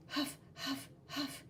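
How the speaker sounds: breathy unvoiced huff huff; panting huffs. Tired red kid panting, whispered huffs. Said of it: "She's getting tired".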